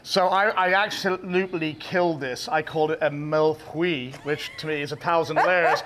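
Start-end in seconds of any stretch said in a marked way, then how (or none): none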